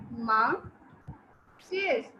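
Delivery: divided in half. Shown as a demonstration. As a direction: break the word in two, MOM... STAIRS. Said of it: "we divide the word in".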